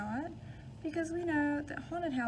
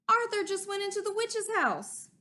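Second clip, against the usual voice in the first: high, louder worried exclaim; alarmed kid; 8 semitones higher, 6 dB louder than usual. Acted. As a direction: sound the alarm to your friends. Buster alarmed at witch's house.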